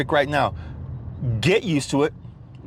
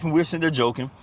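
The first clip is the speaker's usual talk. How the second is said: ordinary talk, nothing marked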